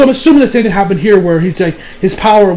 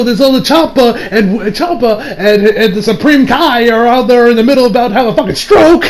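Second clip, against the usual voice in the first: usual speaking voice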